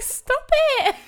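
high, loud mock protest; imitating exasperated Rich. Host retells being told off in Dublin, high mock protest. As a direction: imitate a partner's plea while laughing. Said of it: "Rich was like".

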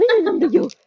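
high, loud mock-excited outburst; excited child imitation. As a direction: mimic a worked-up child, drooling with urgency. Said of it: "興奮してこう、よだれたりしながら". (興奮した子どもの高く大きな物まね)

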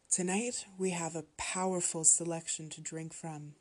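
ordinary delivery

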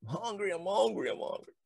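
put-on a hungry boy telling everyone; mock child announcing. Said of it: "announcing it to everybody".

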